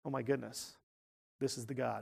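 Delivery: soft and low, struck with awe; a humbled king marvelling under his breath; awed hush. Retold or imitated. Nebuchadnezzar, seeing the fourth man, in hushed awe. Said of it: "repented and said".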